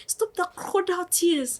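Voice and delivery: very high, loud scolding; shrieked mock telling-off; imitated shouting. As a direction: mimic an angry teacher yelling at a crying pupil. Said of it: "So this one was shouting".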